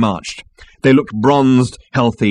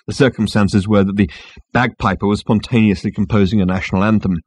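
ordinary delivery

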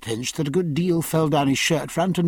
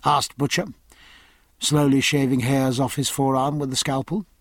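ordinary delivery, nothing marked

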